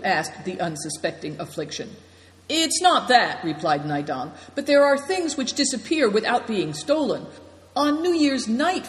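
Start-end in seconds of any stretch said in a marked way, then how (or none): 2.5-8.9 a higher, louder storyteller voice for Naidong; lightly voiced host. A narrator's reading. Naidong explains to his guests, lightly voiced. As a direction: let the host confide, pitched up.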